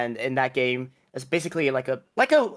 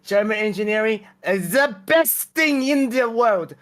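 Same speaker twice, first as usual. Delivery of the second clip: put-on German accent, pitched up; mock-German proclamation, louder on the peaks; 5 semitones higher, 3 dB louder than usual. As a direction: say it like a proud German caricature. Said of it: "Sorry, I had a German accent".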